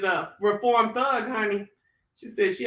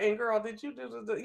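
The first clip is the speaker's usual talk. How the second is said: hushed gossip question; 10 dB quieter than usual. Retold or imitated. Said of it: "I'll be like".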